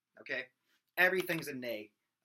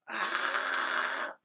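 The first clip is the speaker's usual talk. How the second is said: fry scream; 4 dB louder than usual. Shown as a demonstration. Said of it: "okay, just like that".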